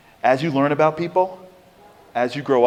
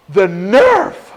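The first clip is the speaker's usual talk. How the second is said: indignant; 5 semitones higher, 7 dB louder than usual. Retold or imitated. Woman indignant at stranger.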